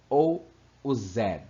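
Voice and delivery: Z said slowly as British zed; British zed demo. Shown as a demonstration. Teacher contrasting zee and zed, slow demo. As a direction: give the English zed slowly. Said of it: "lá nos Estados Unidos ele tem um som".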